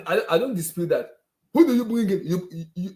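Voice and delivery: usual speaking voice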